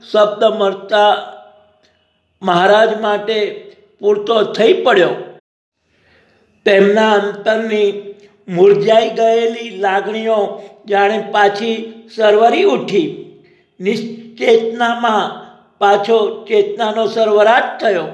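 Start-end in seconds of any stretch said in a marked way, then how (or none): none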